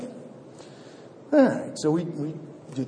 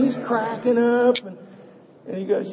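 ordinary talk

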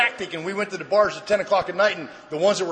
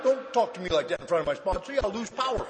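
soft, private aside; hushed inner protest; 2 semitones lower, 9 dB quieter than usual. Retold or imitated. Sponsor silently objects in front of his sponsee, hushed protest. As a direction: protest under the voice, as a thought kept to yourself.

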